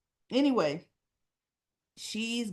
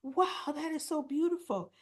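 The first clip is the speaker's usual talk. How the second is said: high, eager mom voice, far above her own; imitating eager mom; 8 semitones higher than usual. Mom begging her daughter for trip photos, high and eager. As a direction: play the mom pestering her daughter to finally share. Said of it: "She goes".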